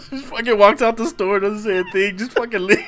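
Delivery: high, loud telling broken by real laughter; laughing. Real, not acted. Storyteller telling how the customer walked out, laughing. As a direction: push the words out through laughter.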